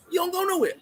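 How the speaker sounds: scoffing retort at the bragger; incredulous retort. Real, not acted. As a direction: shoot the claim down.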